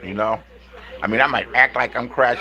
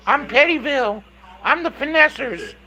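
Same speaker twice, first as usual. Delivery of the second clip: high mocking imitation of a rival's boast; high, sneering mock boast; mocking falsetto quote; 12 semitones higher than usual. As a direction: mimic her boasting in a high put-on voice.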